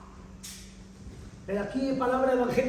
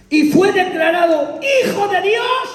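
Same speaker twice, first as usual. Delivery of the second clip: loud, higher, slower proclamation; proclaiming scripture; 7 semitones higher, 8 dB louder, slower than usual. Acted. Preacher reading Romans to the church, proclaiming loudly. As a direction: declare the verse like a herald.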